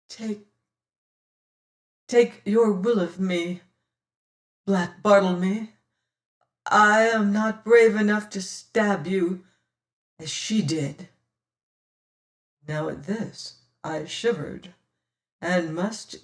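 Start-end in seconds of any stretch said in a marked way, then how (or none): 0.1-11.1 broken and humbled. A narrator's reading. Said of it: "spoke broken and humbled".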